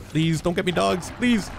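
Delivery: panicked pleading, rising high; being chased. Real, not acted. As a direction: beg not to be caught. Begging while the dogs chase him.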